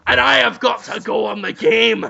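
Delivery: hammed-up high, shouted lament. Poor Victorian housemaid complains of her lot, hammed-up shouted wail. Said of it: "And [name] says".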